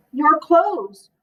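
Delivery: plain reading voice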